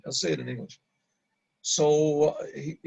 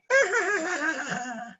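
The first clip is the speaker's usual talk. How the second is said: high, drawn-out mimicked cackle; imitated lady's laugh; 12 semitones higher than usual. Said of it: "a lady laughing".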